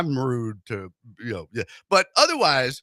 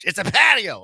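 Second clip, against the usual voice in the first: mock scolding wife; 4 semitones higher, 5 dB louder than usual. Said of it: "My wife yells at me".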